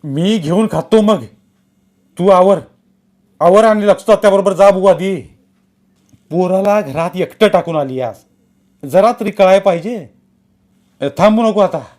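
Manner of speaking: a bossy household elder, pressing and loud; voiced elder relative.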